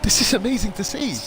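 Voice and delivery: high, excited race call; excited commentator. Real, not acted. Live commentary, much higher.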